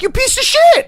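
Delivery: sudden high yell of mock outrage. At his nephew's banquet, a high mock-outraged yell. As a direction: shriek it in mock disgust at the ungrateful kid. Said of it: "And I'm like".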